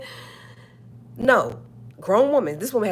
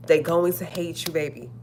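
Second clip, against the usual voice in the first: ordinary talk, nothing marked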